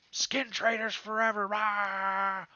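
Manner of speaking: loud, high gang battle cry; defiant war cry. Acted. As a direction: roar the gang's slogan defiantly. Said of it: "You know".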